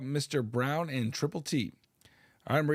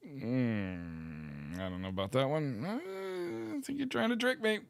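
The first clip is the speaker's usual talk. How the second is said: suspicious; 5 dB quieter than usual. Acted. Streamer plays suspicious of trick.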